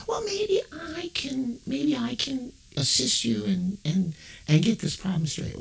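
high, bright phone-rep voice; sugary operator on the phone line; customer service rep. Acted. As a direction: put on a chirpy call-center voice. Rep voice continues.

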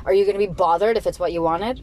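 ordinary delivery

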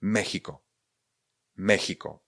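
"Mexico" said Mechiko, with a throat ch. Shown as a demonstration. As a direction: model "Mexico" twice, falling, x as Czech ch. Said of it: "Mexiko se vyslovuje s ch".

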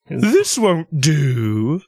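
high, long-drawn hammed-up displeasure; mock disapproval. Riffing on the general expecting a bigger force, mock disapproval. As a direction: draw out each word in theatrical objection. Acting out dissatisfied general in the joke.

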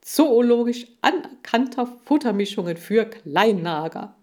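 loud, showy pitchman bragging; boasting salesman. A narrator's reading. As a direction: boast about the brand to a TV audience. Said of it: "protzte Seifried".

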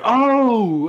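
high, loud startled oh; startled shout. Retold or imitated. Retelling the microwave fire, a loud startled shout. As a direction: shout it like a sudden alarm. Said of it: "then I just hear".

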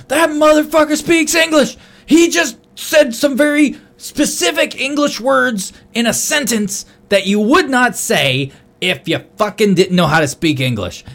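high, loud, slowed-down rant; outraged outburst. Retold or imitated. Jumping in his brother's truck to report the deception, outraged. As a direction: shout it in disbelief, stretching the words.

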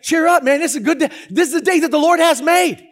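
high, loud, hammed-up cheer; rally a grouch with big gusto; mock-jubilant proclaiming; exuberant pep talk. Mock pep talk to grumpy cops.

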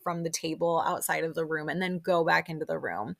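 ordinary talk, nothing marked

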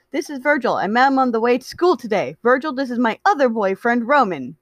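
high, excited introducer; bubbly, eager Patton. Acted. Patton introduces new friend to other boyfriend, bubbly and high.

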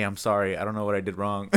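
low, soft retold apology; meek acted-out sorry, dropped in volume; sheepish apology.

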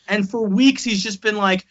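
normal talking voice

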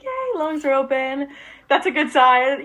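high, drawn-out little yay; mock celebration, slow and high; lightly acted cheer of relief; retold cheer. Acted cheer at newborn puppy breathing.

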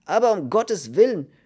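high, loud, aghast outcry; horrified protest. A narrator's reading. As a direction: protest in dismay. Cellmate protests in horror. (entsetzter, lauter Aufschrei)

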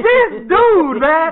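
hammed-up incredulous outburst; comic exasperation. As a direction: cry out as if floored by the nerve. Exasperated at brother's excuse.

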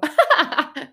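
loud, high laugh at herself; sudden real laugh; high burst of laughter. Real, not acted. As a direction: crack up at your own slip. Laughs at own slip.

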